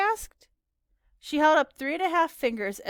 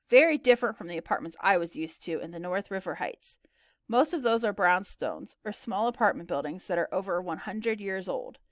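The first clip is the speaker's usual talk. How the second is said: usual speaking voice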